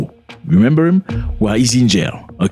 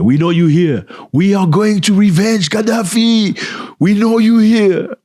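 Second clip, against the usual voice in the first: mock inmate threat; 10 semitones higher, slower than usual. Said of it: "inmates shouting at him and threatening him".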